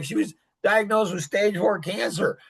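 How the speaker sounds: ordinary talk, nothing marked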